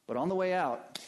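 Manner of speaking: ordinary talk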